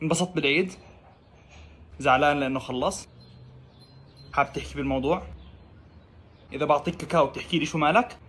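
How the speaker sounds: soft, caring questions; quiet tender concern; gentle coaxing. Acted. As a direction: coax a sad friend softly. Soft coaxing a sad person.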